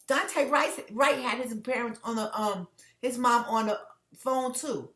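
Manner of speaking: real upset, high and loud; upset, emotional. Real, not acted. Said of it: "that video upset me".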